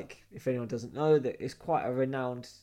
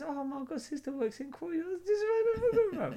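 very high-pitched gushing imitation; hammed-up high, excited chatter; mock excitement. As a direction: squeal it like a thrilled acquaintance, for laughs. Retold bouncer excited at shared hometown.

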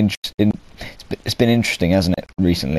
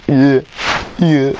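put-on failed Scottish accent; 4 semitones higher than usual. Host mocking his own slip, not-quite-Scottish accent. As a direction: imitate a botched Scottish accent. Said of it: "that yeah was not Scottish".

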